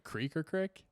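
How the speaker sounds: creek with long ee, crick with short i; contrasted pronunciations. Shown as a demonstration. Correcting the co-host's question, creek versus crick. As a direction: creek against crick, vowels set apart. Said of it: "wondering why we pronounce it".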